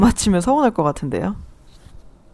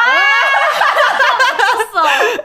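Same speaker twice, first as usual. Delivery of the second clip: loud high shriek of laughter; 8 dB louder than usual. Real, not acted. Laugh alone on line.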